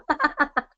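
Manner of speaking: light amused laugh; a short real chuckle. Real, not acted. Laughing at Bob yawning.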